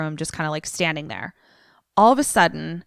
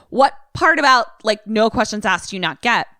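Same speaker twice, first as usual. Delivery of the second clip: high, louder mock exasperation; exasperated; 3 semitones higher, 3 dB louder than usual. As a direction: act out a fed-up boss rebuking her. Acted exasperated Billy rebuking her questions.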